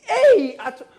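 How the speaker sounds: everyone shouting. Retold or imitated. Town folk shout the catchphrase, loud high calls. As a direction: belt it like a crowd chant. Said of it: "everyone keeps shouting".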